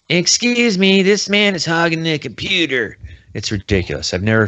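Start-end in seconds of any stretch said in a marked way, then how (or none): 0.1-3.0 put-on high, loud old-man tattling; crotchety old man. Old man tattles to the librarian about Logan, loud geezer whine. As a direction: call out to the librarian like an outraged geezer. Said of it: "He's doing his best Dana Carvey".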